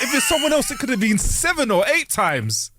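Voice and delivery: high, slow mock consolation; slow, high mock reassurance; mock professor. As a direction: imitate the condescending compliment slowly and high. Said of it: "He's like".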